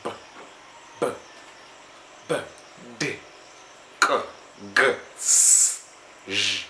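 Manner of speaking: B, D, K, G, S, J as short separate sounds; isolated consonant sounds. Shown as a demonstration. Teacher giving examples of consonants, each sound pronounced on its own. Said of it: "can you pronounce".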